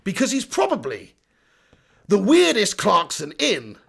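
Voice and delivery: low posh southern English presenter; plummy southern accent, weighty pauses before the punchline; Jeremy Clarkson. Acted. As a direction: deliver it with grand, dramatic pauses. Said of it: "I'm pretending like I'm Jeremy Clarkson".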